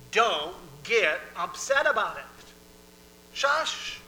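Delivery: loud, emphatic, ending on a shush; firm scolding. Acted. God repeating himself to the fretful, loud firm command. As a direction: order them to settle down.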